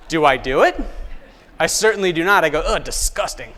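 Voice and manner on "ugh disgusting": a hammed-up grossed-out groan; mock disgust. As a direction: wrinkle your nose at the drink. Acting out his own snobbish reply.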